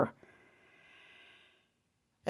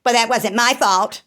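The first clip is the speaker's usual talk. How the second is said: a put-on, higher and louder voice for the dismissive mother; mother brushing off her grandson, cold and dismissive; mother's cold retort; 5 semitones higher, 5 dB louder than usual. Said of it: "my mother says to him".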